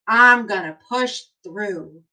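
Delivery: put-on slow, emphatic read-aloud for kids; reading to children. Teacher reading an encouraging book to her class, emphatic. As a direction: read it like a rousing picture book.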